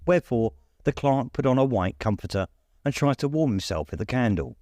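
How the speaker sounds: plain reading voice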